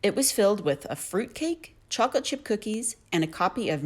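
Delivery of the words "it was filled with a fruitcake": usual speaking voice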